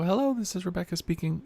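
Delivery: usual speaking voice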